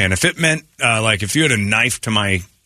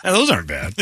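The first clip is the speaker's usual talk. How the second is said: ordinary delivery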